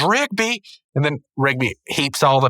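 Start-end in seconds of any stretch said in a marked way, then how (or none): 0.0-0.6 name cried out high; mock exasperated call. Boss pelted with trash again, high exasperated cry. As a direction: imitate a boss scolding by name. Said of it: "He goes".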